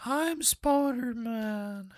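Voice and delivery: high, very slow goofy voice; cartoonish drawn-out hero announcement; silly Spooderman voice. Playing with a silly mispronounced name.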